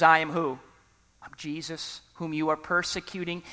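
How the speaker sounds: quiet voice of Jesus. Acted. Jesus answering Saul from heaven, low and hushed. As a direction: let it drop low and soft, almost hushed.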